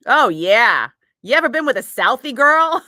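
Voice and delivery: loud, mock Boston Southie voice; Ted impression. Retelling a movie scene to listeners, loud Ted impression. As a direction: imitate a movie character's Southie come-on, loudly. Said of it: "when Ted is like".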